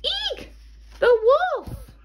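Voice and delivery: high squeal of fright, eek; frightened shriek. Acted. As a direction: shriek out in sudden fear. Little Red shrieks at wolf.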